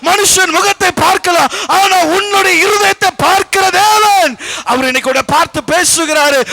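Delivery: high, loud, driving sermon voice; full-force preaching. Acted. Preacher telling listeners God sees their heart, preaching fervently. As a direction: press it home with fervour.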